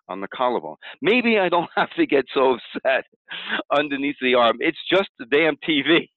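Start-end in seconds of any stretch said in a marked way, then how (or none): 1.0-3.1 higher, laughing as the upset melts; a light chuckle of self-recognition; laughing relief. Shown as a demonstration. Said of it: "You're gonna say things like". 4.6-6.1 higher, a touch dismissive; lightly voiced exasperation; exasperated shrug. Shown as a demonstration. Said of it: "You're gonna say things like".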